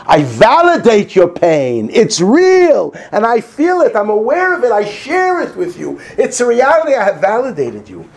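full-force preacher urging them to listen; fervent preaching. Acted. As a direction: drive it home to the congregation.

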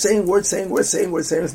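mock rote recital, loud and run-on; mindless rattling. Mocks rote, mindless repeated praying.

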